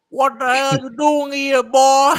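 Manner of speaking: mock aggressive country homeowner; aggressive country accent. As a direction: confront the stranger in a thick country accent. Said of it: "country ass accent".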